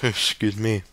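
ordinary delivery

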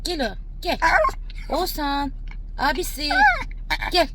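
high, sing-song calls for Eyşan; calling to the dog. Real, not acted. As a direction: call her name high and coaxing. Calling the dog's sister.

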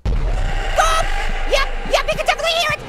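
high, loud panicky voice; alarmed. Real, not acted. Player realizing the monster heard the noise, alarmed. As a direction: raise pitch and volume in sudden alarm.

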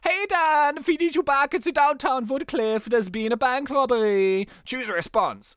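put-on cheerful German-accented officer. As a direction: bark the cop's lines in a hammed-up German accent. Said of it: "I think Paul should be German".

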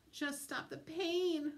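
ordinary delivery

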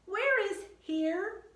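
high, loud, slow called question; high drawn-out call. Acted. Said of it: "Annie called down".

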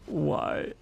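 ordinary delivery

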